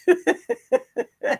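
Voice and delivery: high rising giggle; a quick squeaky laugh. Real, not acted. Laughing at a viewer's joke in chat, high giggle.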